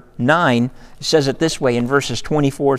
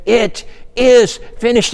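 high, drawn-out dying cry, louder; imitated cry of defeat; 8 semitones higher, 4 dB louder than usual. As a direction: imitate an agonised film Jesus, slow and high. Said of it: "it's not a cry of defeat".

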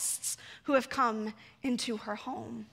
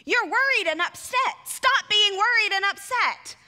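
louder, higher scolding; finger wagging; 5 semitones higher, 6 dB louder than usual. Shown as a demonstration. Jesus scolding anxious Martha, mock stern. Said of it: "we may hear that finger wagging".

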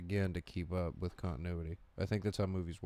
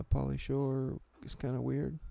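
Brendan Fraser; 5 semitones higher than usual. Acted. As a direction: trail haltingly into the mic. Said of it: "he, like, talks like this into the mic".

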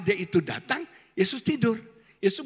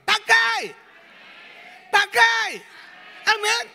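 commanding shout; 11 semitones higher, 5 dB louder than usual. Real, not acted. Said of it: "urging congregation to act".

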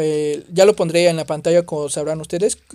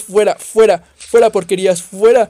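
loud repeated get-away yells; shouting them off; 8 dB louder than usual. Real, not acted. Gamer fighting off monsters, loud shouts. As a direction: drive the creatures back with your voice.

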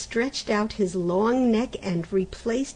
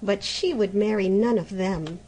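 ordinary delivery, nothing marked

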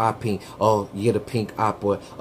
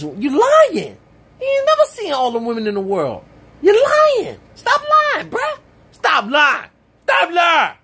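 very high, loud yelling; shouting; 5 dB louder than usual. Acted. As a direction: yell it, outraged and rising. Escalating outraged shouting.

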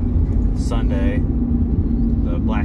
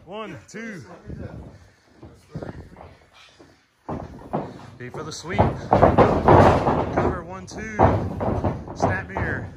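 boxing coach calling combos; 9 semitones higher than usual. Real, not acted. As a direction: bark out each move to the trainee. Coach calling wrestling drills.

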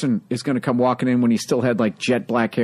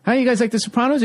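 pushier repeat; 11 semitones higher, faster than usual. Retold or imitated. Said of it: "he goes".

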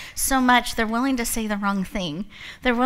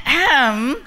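fake throat clear; 3 semitones higher, 8 dB louder than usual. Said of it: "And I went".

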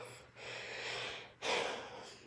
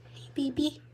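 higher, cooing hello to her lizard; pet talk; 5 semitones higher, 3 dB quieter than usual. Real, not acted. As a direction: coo softly at the pet. Greeting her bearded dragon.

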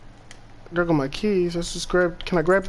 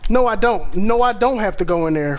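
ordinary talk, nothing marked

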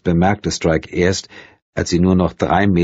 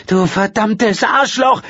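furious cry; 3 dB louder, slower than usual. A narrator's reading. Woman attacks man in rage.

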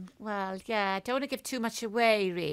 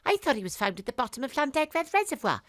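sharp high disbelief on suicide, then wry; incredulous; 5 semitones higher, 4 dB louder than usual. Acted. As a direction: bark the word back in disbelief. Detective incredulous at widow's claim.

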